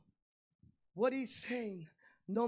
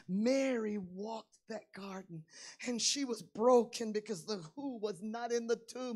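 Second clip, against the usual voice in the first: ordinary delivery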